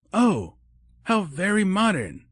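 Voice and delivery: high, bright-put cheer masking surprise; a touch of careful, forced enthusiasm; forced cheerful. Acted. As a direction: sound cheerful and enthusiastic on purpose. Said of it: "careful to keep her voice cheerful and enthusiastic".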